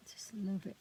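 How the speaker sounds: breathy whispered delight. Real, not acted. Crafter admiring her own page, soft whisper. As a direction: whisper it like a secret treasure.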